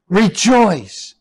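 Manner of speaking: normal talking voice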